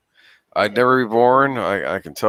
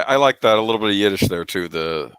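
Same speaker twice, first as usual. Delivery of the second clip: ordinary delivery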